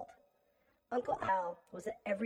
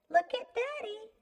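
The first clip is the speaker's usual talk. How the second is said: high-pitched mocking taunt; cruel taunt; 14 semitones higher, 4 dB louder than usual. A narrator's reading. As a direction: taunt them in a high, singsong lilt. Killer taunts narrator with father's head.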